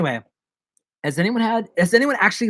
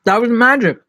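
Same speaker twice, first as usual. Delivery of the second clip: hammed-up; 8 semitones higher, 8 dB louder than usual. Exaggerated declaration.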